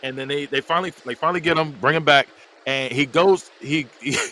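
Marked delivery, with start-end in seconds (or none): none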